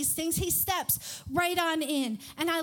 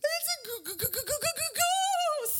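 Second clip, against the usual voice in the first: gasp, then high quavering stutter; hammed-up scaredy-cat stammer building to a high wail; cartoon terror; 11 semitones higher than usual. Speaker imitating Scooby-Doo seeing a ghost, stuttering mock fright. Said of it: "have you ever watched Scooby-Doo".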